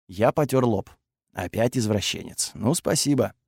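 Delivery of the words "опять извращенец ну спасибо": low, soft, weary sarcasm; dejected aside, voice dropped; resigned echo. A narrator's reading. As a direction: grumble the insult back quietly. He echoes her insult, resigned.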